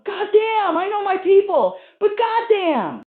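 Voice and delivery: very high, loud cry of disgust; appalled outburst. Acted. Driver reeling from the hitchhiker's odor, appalled high shout. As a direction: wail it out, overwhelmed by a stink.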